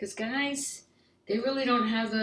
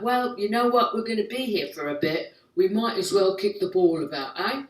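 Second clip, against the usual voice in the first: chirpy mock-English character; English accent; 4 dB louder than usual. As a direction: put on an old-time English accent. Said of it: "soccer started in England in the late eighteen hundreds".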